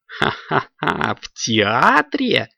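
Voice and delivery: slow, mocking witch's laugh; gloating laugh. A narrator's reading. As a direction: cackle it slowly with malice. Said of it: "послышался злорадный смех".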